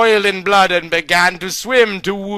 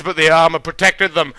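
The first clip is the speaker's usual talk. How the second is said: ordinary delivery, nothing marked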